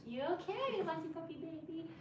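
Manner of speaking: high, soft cooing to a puppy. Real, not acted. Checking on her puppy, cooing baby talk.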